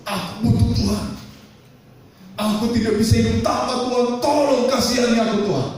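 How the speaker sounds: high, pleading prayer; crying out to God. Acted. A praying heart cries out to God, high and pleading. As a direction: plead for help, pitch lifted. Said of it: "menjerit hatimu kepada Tuhan".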